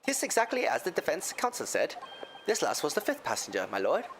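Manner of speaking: easy Irish lilt; Irish accent. Acted. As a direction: answer like an Irish witness. McGilded answers in accent.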